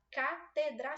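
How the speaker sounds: "Catedra" counted out slowly as ca-te-dra; syllable by syllable. Shown as a demonstration. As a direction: give each syllable of "Catedra" its own beat. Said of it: "são três".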